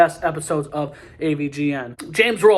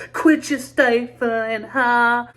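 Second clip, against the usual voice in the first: high sitcom catchphrase voice; 8 semitones higher, 5 dB louder, much slower than usual. Retold or imitated. Quoting a sitcom skit line.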